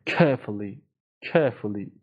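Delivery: British "Carefully" with the R left silent; non-rhotic British "Carefully", first vowel held; British pronunciation. Shown as a demonstration. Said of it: "آر تو تلفظ توی لهجهٔ بریتانیایی".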